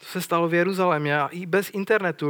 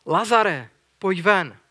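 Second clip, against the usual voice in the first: raised, higher-pitched cry of command; commanding loud call; 3 semitones higher, 6 dB louder, much slower than usual. A narrator's reading. Jesus calls dead Lazarus out of the tomb, loud and commanding. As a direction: call out mightily to summon someone. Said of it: "zvolal mocným hlasem".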